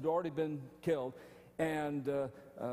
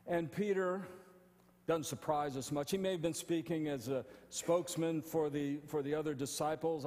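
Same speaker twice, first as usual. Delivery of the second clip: ordinary delivery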